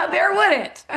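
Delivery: high, laughing reply. Real, not acted. Answering her own question about war, laughing.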